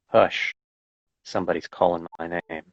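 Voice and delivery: measured, deliberate poetry reading; poem reading. Acted. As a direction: read it like a poem, letting each image land. Reads a poem aloud.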